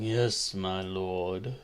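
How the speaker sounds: low, hushed servant's reply; very soft and low, as a servant to his lord; deferential servant. Acted. Butler Jenkins answers the lord who summoned him, low and deferential. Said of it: "And he's".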